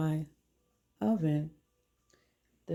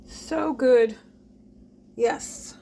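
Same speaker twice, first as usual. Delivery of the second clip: excited; 7 semitones higher, 5 dB louder, much slower than usual. Real, not acted. Exclaims about the bread.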